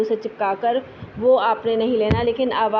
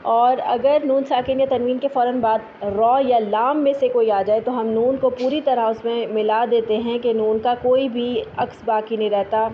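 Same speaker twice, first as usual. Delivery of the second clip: usual speaking voice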